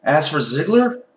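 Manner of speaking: usual speaking voice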